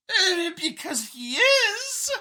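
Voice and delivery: a high, slightly tearful laugh; Xeno scientist, amused. A narrator's reading. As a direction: chuckle through it in the Xeno's high voice. Xeno explains the copy.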